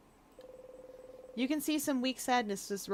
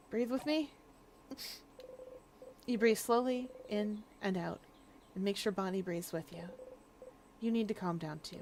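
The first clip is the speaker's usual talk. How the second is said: calming; 6 dB quieter than usual. Acted. Calming Bonnie.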